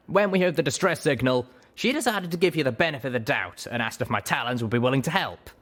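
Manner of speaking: brisk, businesslike griffin; griffin captain. A narrator's reading. Griffin captain explains her Talons came to help, businesslike.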